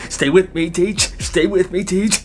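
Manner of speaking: rising, urgent plea; student doing CPR on the teacher, urgent; urgent pleading. Acted. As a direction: beg the teacher to hold on.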